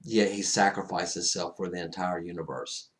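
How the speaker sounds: ordinary talk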